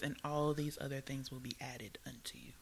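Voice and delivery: hushed whisper of scripture. Real, not acted. Quoting the Bible to listeners in a hushed whisper. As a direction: breathe the verse softly.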